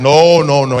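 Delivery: ordinary talk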